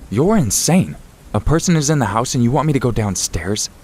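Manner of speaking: incredulous protest. A narrator's reading. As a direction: read it as an indignant, disbelieving objection.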